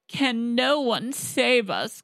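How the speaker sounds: high, slow desperate cry of the king; panicked king crying out in the storm. Acted. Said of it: "cried the king".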